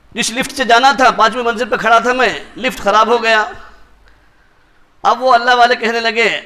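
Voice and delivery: ordinary delivery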